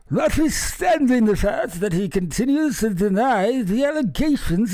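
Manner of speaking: high, slow pompous read; mock royal voice. Reading the palace statement to viewers, pompous mock royal. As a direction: intone it like a stuffy herald.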